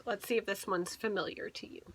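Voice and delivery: soft unvoiced aside; whisper. Real, not acted. Parent whispering an aside to the listening child.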